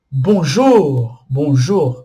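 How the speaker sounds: bonjour with the weight on -jour; final-syllable stress. Shown as a demonstration. Teacher demonstrating French stress to students, last syllable stressed. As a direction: push the end of bonjour. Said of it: "a última sílaba é a tônica".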